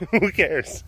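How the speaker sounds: ordinary talk, nothing marked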